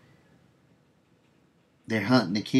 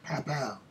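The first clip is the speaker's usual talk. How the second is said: imitated gunshots; 6 semitones higher, 7 dB quieter than usual.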